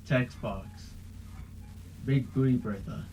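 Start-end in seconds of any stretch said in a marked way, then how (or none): 2.0-3.0 flat robotic speech-synth voice; Stephen Hawking voice. Retold or imitated. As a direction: read it like a computer voice. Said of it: "it's like saying in a robotic voice".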